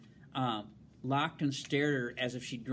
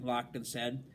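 usual speaking voice

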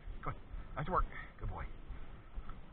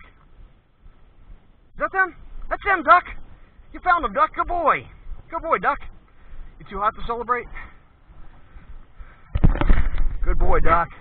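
much higher and louder, gushing over the dog's find; excited praise for the dog; 10 semitones higher, 13 dB louder, slower than usual. Real, not acted. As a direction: burst into thrilled praise for a good dog. Dog finds the hidden people.

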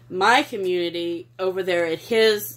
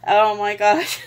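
normal talking voice

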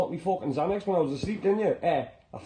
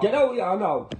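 angry shout; 4 dB louder than usual. Acted. Quarrel, squaring up.

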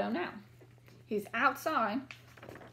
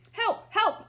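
calling for help; 9 semitones higher, 5 dB louder than usual. Acted.

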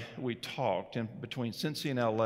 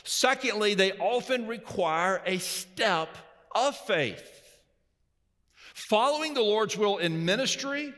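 raised, ringing preacher voice; preaching; 9 semitones higher, 3 dB louder than usual. Acted. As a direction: preach it out to the congregation. Preaching at full force.

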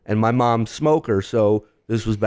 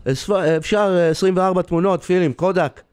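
lightly voiced Israeli customer, higher pitch; slightly put-on, higher customer voice; Hebrew-speaking customer; 6 semitones higher, much faster than usual. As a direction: imitate a customer asking in Hebrew. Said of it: "And he says again".

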